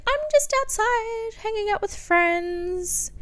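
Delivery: high, slow, sweet innocent voice; innocent fib to mom. Retold or imitated. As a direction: sound like nothing is going on. Lying to mom on the phone.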